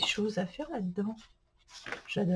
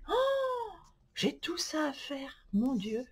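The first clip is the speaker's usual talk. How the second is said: despairing whisper; 6 semitones higher, 8 dB quieter, slower than usual. Real, not acted. Overwhelmed by unfinished pages.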